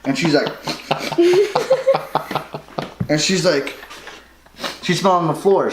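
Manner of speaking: normal talking voice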